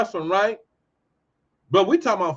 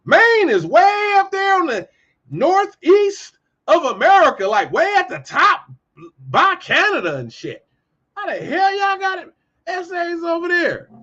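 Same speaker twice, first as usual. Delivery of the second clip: higher, louder mock astonishment; hammed-up high shocked voice; 8 semitones higher, 4 dB louder than usual. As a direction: act out gaping disbelief. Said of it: "You'll be like".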